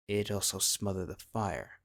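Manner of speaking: ordinary delivery, nothing marked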